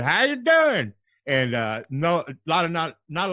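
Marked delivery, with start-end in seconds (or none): 0.0-0.9 catchphrase. Retold or imitated. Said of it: "I've always said".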